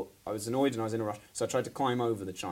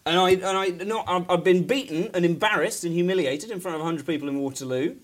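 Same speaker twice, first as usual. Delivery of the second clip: higher, much louder, laughing indignant rant; mock outrage; 9 semitones higher, 9 dB louder than usual. A guest ranting at the late co-host in mock fury. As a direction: blame the co-host in a hammed-up fury.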